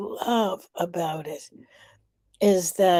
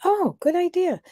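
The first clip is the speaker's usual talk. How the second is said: high bright "Oh, good idea", pitched way up; a light mock discovery, voice jumping up on oh; delighted oh; 11 semitones higher than usual. Hearing a community member's tip, pleasantly surprised. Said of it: "And I'm like".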